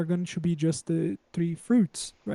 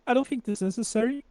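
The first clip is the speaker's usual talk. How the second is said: usual speaking voice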